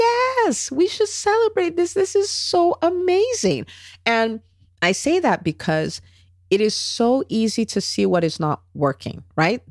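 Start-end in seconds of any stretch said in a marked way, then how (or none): 0.1-3.7 high, gushing mock delight; over-the-top excitement. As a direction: squeal it out like big news. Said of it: "I'm like".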